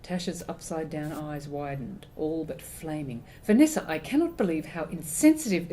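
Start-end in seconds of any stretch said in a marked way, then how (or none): 3.4-5.7 mother scolding. A narrator's reading. Mum scolding.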